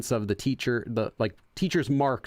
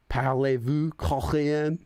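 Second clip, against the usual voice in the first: slow, hammed-up French accent question; mock French accent; slower than usual. Shown as a demonstration. Streamer joking to viewers in a mock French accent. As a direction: ask it like a cartoon Parisian.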